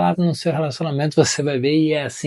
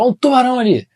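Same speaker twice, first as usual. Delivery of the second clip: "tubarão" higher, louder and faster; quick, raised, urgent warning; alarmed intonation; 11 semitones higher, 6 dB louder than usual. Shown as a demonstration. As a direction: sound the shark alarm. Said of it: "Eu até usei a entonação".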